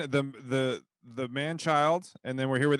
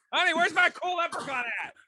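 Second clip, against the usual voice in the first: high mock whine. Playing Darren calling his wife.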